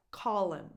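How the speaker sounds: "Call" stressed, "him" reduced to "im"; dropped H. Shown as a demonstration. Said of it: "not really pronouncing the H anymore".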